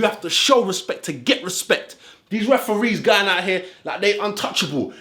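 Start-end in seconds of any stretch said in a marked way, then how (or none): none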